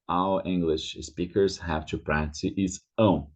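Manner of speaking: ordinary talk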